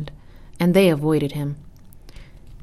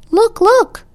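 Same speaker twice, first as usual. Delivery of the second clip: very high, loud call; excited child's cry; 8 dB louder than usual. A narrator's reading. Child spots geese overhead and calls out, excited cry. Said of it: "cried one child".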